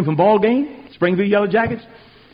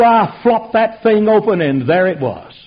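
ordinary talk, nothing marked

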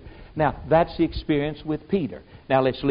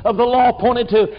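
fervent preaching; 7 semitones higher, 6 dB louder than usual. Real, not acted. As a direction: proclaim it from the pulpit, drawing it out. Preaching at full force, scripture.

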